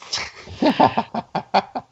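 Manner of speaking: breathy, mostly voiceless laughter; real laugh. Real, not acted. Co-host amused by the talking Yoju idea, real laugh.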